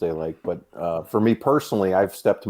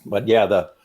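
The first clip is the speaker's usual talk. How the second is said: ordinary talk, nothing marked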